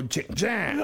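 imitated dial click and return; low mouthed dialling effect; rotary dial noise. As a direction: let the mouthed dial spin back. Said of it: "that means man's dialing".

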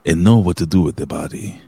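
slow, put-on Spanish accent; careful Spanish-accented English, syllable by syllable. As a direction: read it as a mock Spanish speaker. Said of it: "do it in a Spanish accent".

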